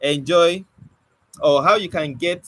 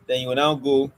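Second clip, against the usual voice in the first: normal talking voice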